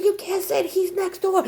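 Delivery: high-pitched pleading wail; mortified yelling. Retold or imitated. Girl warns mom crush is next door, mortified yelling. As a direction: plead with mom in acted panic. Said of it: "And I'm yelling".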